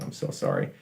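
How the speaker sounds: whispered, sheepish apology; whispered aside. Real, not acted.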